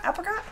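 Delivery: APRICOT with a short A as in apple, rising; questioning "apricot", back to the vowel of cat; trying out a pronunciation. Shown as a demonstration. Streamer checking her own pronunciation, questioning apricot with short A. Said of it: "how did you just pronounce apricots".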